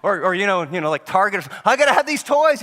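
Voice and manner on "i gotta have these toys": high, loud mock kid demand; demanding child. Child in Target demanding toys, high whiny demand. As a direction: insist like a spoiled kid.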